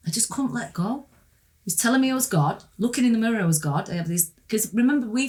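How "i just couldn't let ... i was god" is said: normal talking voice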